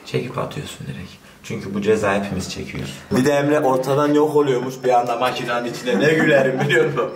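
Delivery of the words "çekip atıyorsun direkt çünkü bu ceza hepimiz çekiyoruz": hushed whisper. Real, not acted. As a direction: whisper it so he won't hear. Secret instruction off camera.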